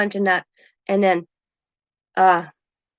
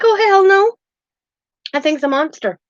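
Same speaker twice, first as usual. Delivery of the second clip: much higher, louder mock outburst; hammed-up horrified no; emphatic refusal; 11 semitones higher, 4 dB louder than usual. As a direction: blurt it out, no way. Retold refusal of roll top desk.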